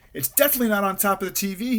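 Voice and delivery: high, insistent fretting; panicked. A narrator's reading. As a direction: panic rising into a high pitch. Panicked Rabbit checks again.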